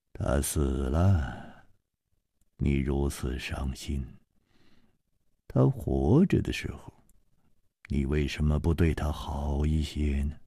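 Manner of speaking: put-on calm older hero. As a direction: speak low and unhurried, gently reproaching. Chu Liuxiang consoles Yijian.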